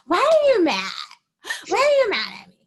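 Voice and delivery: high, loud mock-innocent whine; imitating her friend's high innocent whine; mock whiny innocence. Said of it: "you were like".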